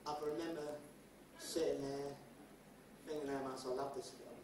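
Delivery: mock tearful lament, soft and low; mock heartbreak. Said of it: "I'm pouring my eye out".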